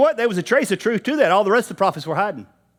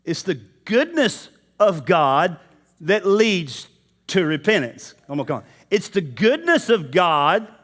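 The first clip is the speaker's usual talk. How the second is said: usual speaking voice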